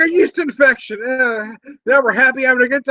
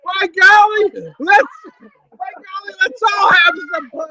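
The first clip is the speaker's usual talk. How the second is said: hammed-up old-timer cheer; 5 semitones higher than usual. Rallying the party for pie, hammed-up folksy cheer. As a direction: crow it like a folksy grandpa.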